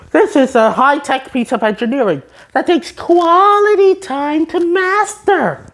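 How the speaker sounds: high, slowing boastful voice; proud SpongeBob. Retold or imitated. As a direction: show off, slowing at the end.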